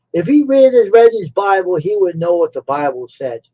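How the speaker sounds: ordinary delivery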